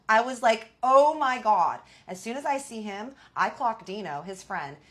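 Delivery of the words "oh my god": loud, high, drawn-out retold shock; shocked exclamation. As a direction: exclaim it slowly in mock horror. Reliving shock at recognizing husband.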